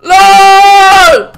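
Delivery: high shouted protest; loud shout. Real, not acted. Protesting a joke.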